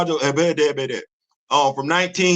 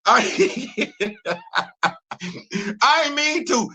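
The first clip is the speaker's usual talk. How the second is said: high, laughing through the words; giggling helplessly mid-sentence; 7 semitones higher, much slower than usual. Real, not acted. Retelling the president scene, laughing high.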